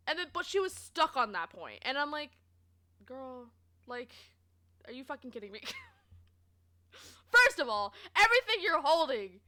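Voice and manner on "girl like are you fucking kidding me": softer, under-the-breath "are you fucking kidding me"; quiet exasperated aside to self; disbelieving mutter. Retold or imitated. Retold inner reaction to customer.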